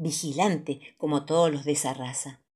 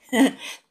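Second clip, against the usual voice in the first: family laughter; 6 semitones higher than usual. A narrator's reading. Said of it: "Todos se ríen".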